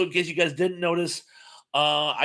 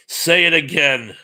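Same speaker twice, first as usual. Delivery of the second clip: threatening demand; 8 dB louder than usual. Retold or imitated. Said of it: "And he goes".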